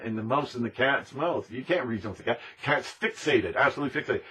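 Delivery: normal talking voice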